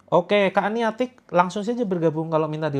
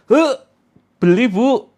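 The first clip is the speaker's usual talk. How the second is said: much higher and louder sudden call to the shop owner; customer calling; 9 semitones higher, 10 dB louder, slower than usual. Retold or imitated. Customer interrupting a nauseous seller, sudden loud high call. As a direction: blurt it as a buyer interrupting. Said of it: "Mau muntah kan?".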